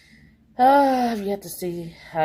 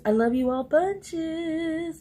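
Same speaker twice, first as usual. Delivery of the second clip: affectionate sign-off; 8 semitones higher, much slower than usual. Real, not acted. Sign-off to viewers.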